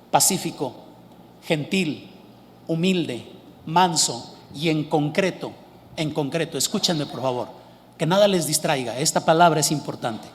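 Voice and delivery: ordinary talk, nothing marked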